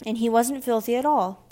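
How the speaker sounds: loud, lifted defence; mildly affronted protest; indignant. A narrator's reading. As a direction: stand up for a slandered friend. Tabitha defends her mouse.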